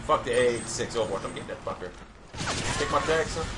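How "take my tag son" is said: ordinary delivery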